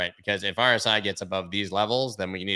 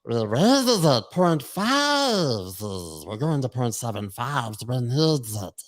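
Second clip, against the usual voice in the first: Smeagol voice; 3 semitones higher, slower than usual. Retold or imitated. Vlogger imagining the Fed chair speaking like Smeagol, creepy. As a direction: hiss it out like Gollum, drawn out. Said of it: "like Smeagol".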